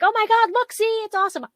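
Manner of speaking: high, excited cheer. Retold or imitated. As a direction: cheer it like a thrilled husband. Husband quoted cheering the shot.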